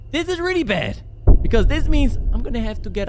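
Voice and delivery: really said high in alarm; rising panic. Real, not acted. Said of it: "repeated escalation".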